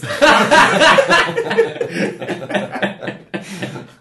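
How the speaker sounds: breathless laugh; airy laugh. Real, not acted. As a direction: laugh through the punchline. Laughing at own joke.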